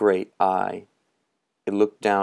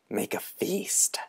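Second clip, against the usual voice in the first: slow, hushed whisper; 2 semitones higher, 7 dB quieter than usual. Acted. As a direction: breathe it like a secret. Moundshroud hushes mid-temptation.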